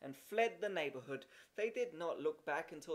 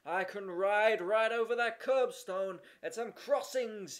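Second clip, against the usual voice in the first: put-on slow, louder boyish boast; bright announcement; 3 semitones higher, 7 dB louder than usual. A narrator's reading. Horace announcing his feat to the group, bright boast. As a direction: proudly announce it. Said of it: "he announced brightly".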